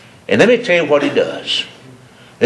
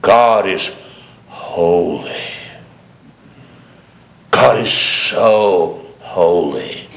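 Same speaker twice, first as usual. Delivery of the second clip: slow, drawn-out, lower mock-solemn preacher; mock pious preacher; 6 semitones lower, slower than usual. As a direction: parody a preacher dragging out God is so holy. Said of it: "they'll say it like this".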